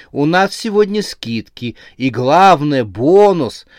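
fervent sales pitch. A narrator's reading. Saleswoman talks a hesitant Max into buying, with fervour. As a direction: push the deal with real zeal. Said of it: "начала с жаром говорить продавщица".

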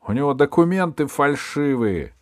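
громкое высокое обвинение; обличай самозванца во весь голос; возмущение. A narrator's reading. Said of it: "возмущался камердинер". (громко, с возмущением)

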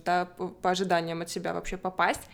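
ordinary delivery

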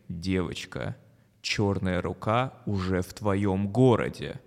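low, slow menacing voice; creepy broadcast voice, drawn out; scary voice. Acted. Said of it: "Страшный голос опять говорит".

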